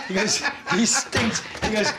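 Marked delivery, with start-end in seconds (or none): 0.7-1.4 higher-pitched mock disgust; retold attack. Carson attacking the comic who drives him, mock scorn. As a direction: put him down as the heckling passenger. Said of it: "just attacking him while he's driving".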